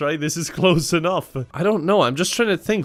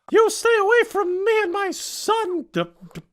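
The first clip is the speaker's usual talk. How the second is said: much higher, shouted protective mother; high melodramatic shout. Acted. Mother protecting her son from intruder, shrill melodramatic yell.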